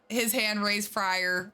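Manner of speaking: ordinary talk, nothing marked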